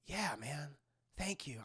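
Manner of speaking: soft, low acted thanks; quiet gratitude. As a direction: say it small and humble. Happy's grateful reply, acted.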